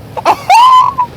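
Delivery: high, loud burst of laughter; a sharp squeal of laughter; shrieking laugh. Real, not acted.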